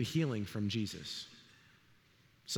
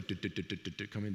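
imitated footsteps; 6 semitones lower, 6 dB quieter, faster than usual. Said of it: "I heard the do".

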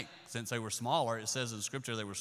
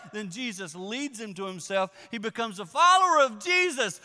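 full-force preaching; 13 semitones higher, 10 dB louder than usual. Acted. Preacher building the story to the church, loud. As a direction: drive the story home to the congregation.

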